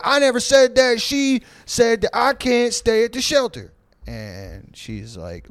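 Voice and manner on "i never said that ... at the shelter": high whiny denial. Retold or imitated. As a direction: shrink into a squeaky excuse. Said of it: "He's just like".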